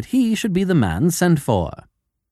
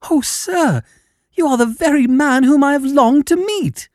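high, eager little man's voice; little fellow gushing in a high voice; eager cry; 12 semitones higher, 4 dB louder than usual. A narrator's reading. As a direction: cry out with overwhelming eagerness, pitched right up. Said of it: "cried the little fellow with outstretched hands".

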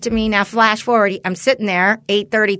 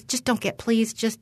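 hushed plea; 8 dB quieter than usual. Retold or imitated. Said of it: "she goes".

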